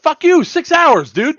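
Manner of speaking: half-joking angry yell at the airline; upset outburst. Real, not acted. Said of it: "sounds like somebody's a little upset here".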